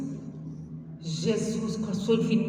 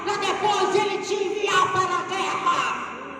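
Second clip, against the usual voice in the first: high, drawn-out rallying cry; rally the church like troops, words stretched out; slow, chanting sermon shout; full-force preaching; 10 semitones higher than usual. Real, not acted.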